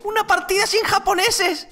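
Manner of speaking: can't believe his luck, squeal it; thrilled disbelief. Real, not acted. Delighted at a lobby without lag. (chillido de alegría incrédula, agudo)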